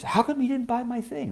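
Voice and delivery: high put-on voice of a confronting wife; imagined wife's high mock accusation; mock complaining wife.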